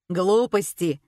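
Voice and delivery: plain reading voice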